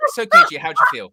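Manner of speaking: squealing high-pitched mock question; mock interviewer shout. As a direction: shriek it like a cheeky interviewer.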